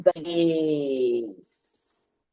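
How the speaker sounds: ordinary talk, nothing marked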